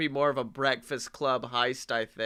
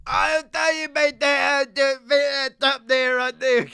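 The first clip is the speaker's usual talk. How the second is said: loud, high, shouted thick Cockney; over-the-top Cockney; 8 semitones higher, 7 dB louder than usual. Acted. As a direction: bellow it like a heist-movie Cockney thug. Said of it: "over Cockney".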